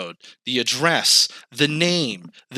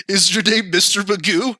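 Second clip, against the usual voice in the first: mock agent; 7 semitones higher, 4 dB louder than usual. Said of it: "she's like".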